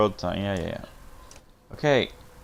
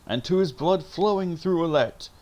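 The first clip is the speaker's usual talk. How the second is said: raised, high rallying cry; shouted toast; 7 semitones higher than usual. Acted. Someone shouts a toast to the caravan, rallying. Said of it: "someone shouts".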